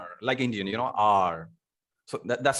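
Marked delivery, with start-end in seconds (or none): 0.0-0.1 American "are" with a clear r; drawn-out American "arr". Shown as a demonstration. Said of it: "Americans are". 1.0-1.5 low American "are", r sounded; long, low American "arr". Shown as a demonstration. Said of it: "Americans are".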